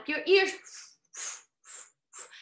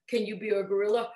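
usual speaking voice

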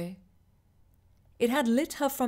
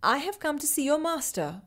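higher, nervous plea to a spirit; a touch of shaky nerves, higher; shakily; 6 semitones higher than usual. Acted. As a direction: push the words out past her fear. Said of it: "Riva said shakily".

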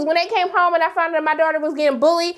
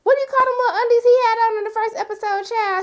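higher, fast, amused question; 9 semitones higher, faster than usual. Real, not acted. A vlogger asking viewers about the undies, high and amused. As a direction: toss it out laughing.

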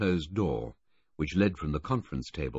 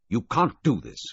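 furious; 6 semitones higher, 6 dB louder than usual. A narrator's reading. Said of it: "Filmer said furiously".

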